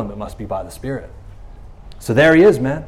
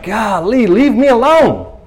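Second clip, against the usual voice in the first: slow, higher, fed-up groan; exasperated; 6 semitones higher, 4 dB louder, slower than usual. Pretend. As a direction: beg to be left alone, drawn out. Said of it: "It's like".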